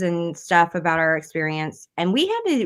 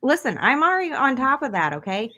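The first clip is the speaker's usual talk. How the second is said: defensive retort; 7 semitones higher than usual. Real, not acted. Retorts to host's scolding.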